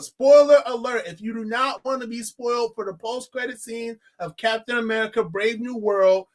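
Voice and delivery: usual speaking voice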